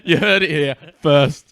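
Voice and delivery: loud, slow mock announcer voice. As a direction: proclaim it like a news anchor. Mock announcement after a joke.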